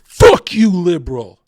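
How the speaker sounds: loud angry blast; yelled retort. Real, not acted. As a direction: bark it at them. Snapping back at a quoted critic.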